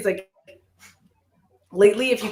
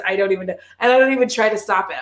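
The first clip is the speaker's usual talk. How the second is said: ordinary talk, nothing marked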